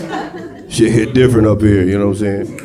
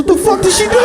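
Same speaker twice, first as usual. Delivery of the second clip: shocked yell. Retold or imitated. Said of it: "I said".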